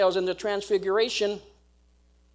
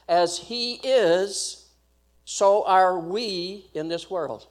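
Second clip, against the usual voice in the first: slow scripture reading; much slower than usual. Shown as a demonstration. Reading scripture verse slowly.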